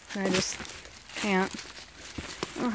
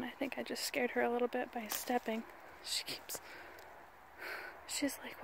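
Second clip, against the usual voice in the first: hushed so the dog can listen; soft breathy aside; whisper; 16 dB quieter than usual. Real, not acted. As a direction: whisper softly, not to disturb.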